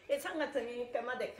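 usual speaking voice

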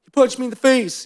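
put-on very high, squeaky child's wail; imitated crying. Daughter repeats the accusation to her dad, mock crying. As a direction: mimic an upset little girl's tearful complaint. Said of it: "He started crying and going on and on".

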